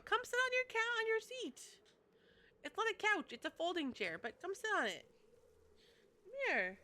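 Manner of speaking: high sing-song coaxing; sweet talk the cat over in a high voice. Real, not acted. Streamer coaxing her cat onto the folding chair, high and cutesy.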